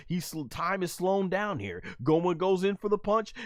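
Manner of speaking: usual speaking voice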